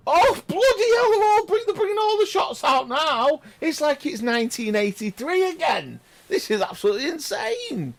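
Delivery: very high, loud, fevered yelling; excited shout. Real, not acted. Commentator thrilled by the attacking shots, high shout. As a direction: shriek it out, carried away.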